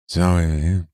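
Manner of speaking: lightly imitated drunk mumbling; slurred, indistinct aside, as if leaning over drunk; drunk stranger's mumble. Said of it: "he's drunk, and he says to Peru".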